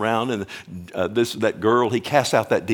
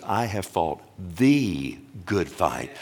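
"the" stressed, slow and lower; 4 semitones lower, 3 dB quieter, slower than usual. Shown as a demonstration. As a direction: hit "the" before "good fight", slowly. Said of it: "meaning there is only one good fight".

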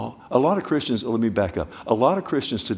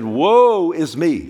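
loud, higher, slow anguished woe; Isaiah's cry of woe; 6 semitones higher, 7 dB louder, much slower than usual. Retold or imitated. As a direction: cry it out slowly, undone before God. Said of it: "he said".

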